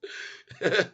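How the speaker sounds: a short high giggle; can't hold back a giggle. Real, not acted. Giggling at the woman's face, a high short giggle. Laughing at the clip.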